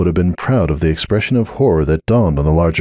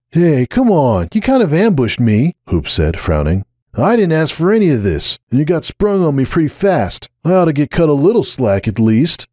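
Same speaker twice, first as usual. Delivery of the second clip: put-on higher, aggrieved young stallion; protesting Hoops; 8 semitones higher than usual. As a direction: push back, half offended.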